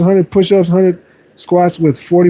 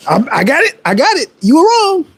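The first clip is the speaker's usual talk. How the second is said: much higher, teasing voice for you were wrong; high taunting gloat; 9 semitones higher than usual. Real, not acted. Gloating at doubters in chat.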